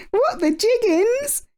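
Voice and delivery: high, slow astonished outburst; shocked gasp. A narrator's reading. Little bees learning Norman is a bear, high astonished gasp. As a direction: gasp in disbelief. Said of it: "gasped the little bees".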